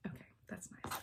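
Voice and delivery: breathy whisper to the dog. Real, not acted. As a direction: whisper it so the dog stays calm. Soothing the dog mid-task.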